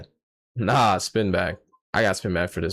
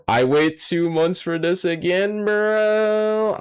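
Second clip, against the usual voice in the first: 1 exasperated whine; 7 semitones higher, much slower than usual. Real, not acted. Complaining about the chill chapter.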